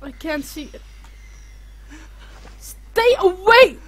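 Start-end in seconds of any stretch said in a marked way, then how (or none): 2.6-3.8 loud, high and slow; scared yell. Real, not acted. Gamer yelling at the monster, slow frightened shout. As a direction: yell at it to stay away.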